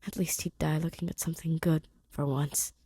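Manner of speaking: plain reading voice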